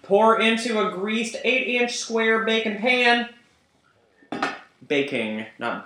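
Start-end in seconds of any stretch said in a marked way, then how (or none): 0.0-3.3 higher, louder, slow put-on old Southern granny drawl; Granny's hillbilly accent. Reading the cornbread recipe for the cookbook, in a hammed-up Southern granny accent. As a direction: stretch the recipe out like a folksy hillbilly grandma. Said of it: "I'm not doing the accent anymore".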